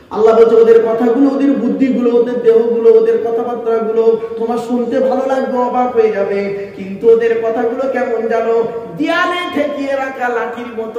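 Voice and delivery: loud fervent waz preaching; full-force preaching. Acted. Preacher rousing the congregation with a loud sermon. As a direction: drive each line home to the crowd.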